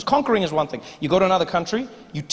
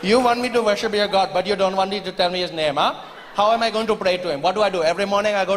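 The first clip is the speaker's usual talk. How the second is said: put-on Indian accent; faster than usual. Indian farmer mocking.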